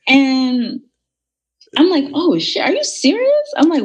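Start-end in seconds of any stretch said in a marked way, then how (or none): 2.1-3.5 high, startled outburst, half laughing; shocked disbelief. Retold or imitated. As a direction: react as if you can't believe what you're reading. Retelling shock at the screenshots.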